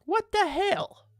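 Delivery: slow, very high incredulous question; bewildered disbelief. Real, not acted. Streamer reacting to chat's antics, slow high bewilderment.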